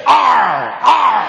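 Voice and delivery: growled pirate "R, R". Retold or imitated. Pirates can only say R.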